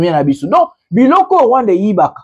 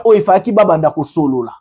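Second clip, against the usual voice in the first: ordinary delivery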